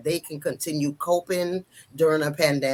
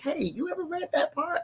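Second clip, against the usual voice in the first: high, soft sing-song question; playful probing; 12 semitones higher, 7 dB quieter than usual. Pretend. Woman pretending to text her ex, playfully probing. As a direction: ask it high and small, coyly.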